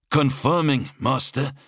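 high-pitched subordinate's report; high, strained-up servant voice; anxious officer reporting. A narrator's reading. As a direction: report to a feared master, voice pitched right up. Officer answers his master.

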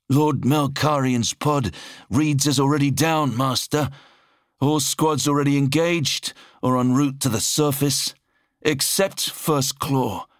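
high-pitched subordinate's report; high, strained-up servant voice; anxious officer reporting. A narrator's reading. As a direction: report to a feared master, voice pitched right up. Officer reporting to his master.

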